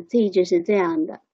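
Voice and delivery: ordinary talk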